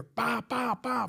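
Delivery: high, popping mock gunfire; imitated gunshots. Officer recounts hearing five shots, imitated gunfire. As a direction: fire off evenly spaced vocal pops. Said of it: "We hear".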